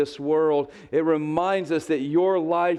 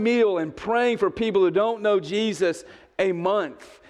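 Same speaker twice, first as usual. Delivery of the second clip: higher, pressing challenge; fervent preaching; 6 semitones higher than usual. Acted. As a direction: urge the church on. Preaching at full force.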